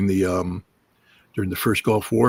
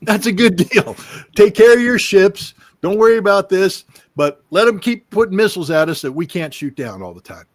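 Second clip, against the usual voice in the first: much higher, louder sarcastic cheerful voice; sarcastic mock approval; 10 semitones higher, 6 dB louder than usual. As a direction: ham up fake agreement, pitched way up. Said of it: "you think Israel would say".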